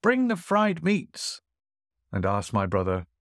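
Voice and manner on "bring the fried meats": high, slow call to the servants; grand host calling for the next dish, high and drawn out. Acted. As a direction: sing it out to the kitchen. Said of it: "the host cried out".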